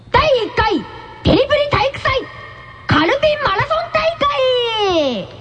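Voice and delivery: high, rising title call; big event announcement. Real, not acted. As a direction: announce the contest title with mounting fanfare.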